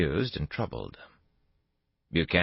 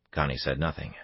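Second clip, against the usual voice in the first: plain reading voice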